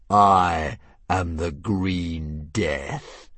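very slow, looming dragon name; grand announcement. Acted. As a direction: savour your own terrible name.

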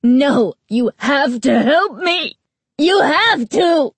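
high, loud pleading shout; desperate shouting. Acted. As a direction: beg at the top of your voice. Girl shouts, begging for help.

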